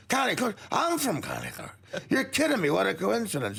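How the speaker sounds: high, astonished mock Irishman; delighted bad-Irish-accent disbelief; lame Irish brogue, amazed. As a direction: exclaim in a hokey Irish brogue, pitch leaping. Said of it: "do a lame brogue too here".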